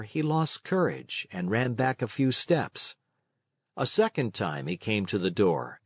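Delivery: plain reading voice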